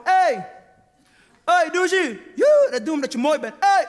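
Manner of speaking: mock catcall. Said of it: "wij roepen heel vaak naar jullie".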